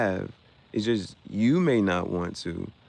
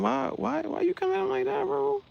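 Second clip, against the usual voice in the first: much higher, incredulous whine; shocked disbelief; 5 dB quieter than usual. Real, not acted. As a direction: act stunned by the comment. Reacting to a crazy comment.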